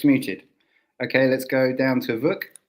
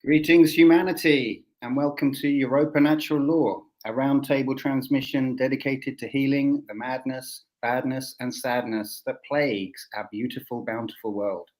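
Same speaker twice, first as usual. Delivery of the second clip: announcer intro; 3 semitones higher than usual. Acted. Scripted show opening.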